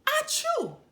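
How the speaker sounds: high, loud squeaky sneeze; baby sneeze. A narrator's reading. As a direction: sneeze like a tiny baby elephant. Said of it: "the baby elephant sneezed".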